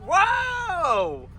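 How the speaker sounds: high shrieking laughter; wild rambling cackles. Real, not acted. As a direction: cackle wildly.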